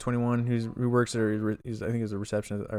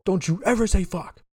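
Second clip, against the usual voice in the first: higher-pitched parental telling-off; mock scolding parent; 8 semitones higher, 3 dB louder than usual. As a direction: play the parent laying down the law.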